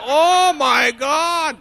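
high, slow, drawn-out gasp of shock; hearing the woman shout about wolves; stunned. Retold or imitated. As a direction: react in alarm. Stunned reaction to her shout.

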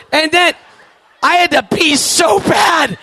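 comic desperate wail. Real, not acted. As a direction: sell the desperation. Desperate need building.

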